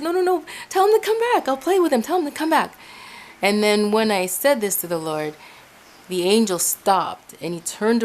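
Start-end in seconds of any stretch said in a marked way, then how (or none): 0.0-2.7 high-pitched retold plea; reenacted pleading, much higher voice; urgent pleading. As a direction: act out begging someone urgently. Begging for angel to return.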